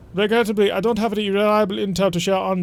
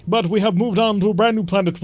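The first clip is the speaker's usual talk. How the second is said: ordinary talk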